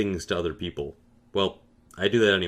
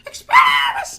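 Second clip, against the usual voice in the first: very high, louder, frantic squeal; repeated shrieked spell; 8 dB louder than usual. Retold or imitated. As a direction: keep screaming the spell over and over. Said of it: "he keeps yelling".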